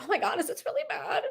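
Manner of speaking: high, soft anxious question; mock worry. Asking her husband about the video, playfully worried. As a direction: ask it small and high, wanting reassurance.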